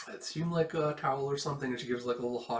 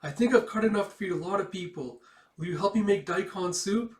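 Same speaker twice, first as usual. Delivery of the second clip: put-on high, loud, forced-bright girl's voice; holding back tears; 8 semitones higher, 7 dB louder than usual. Girl hiding tears from Micah, forced cheerful high voice. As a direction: fake cheer to hide the tears. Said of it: "trying not to cry".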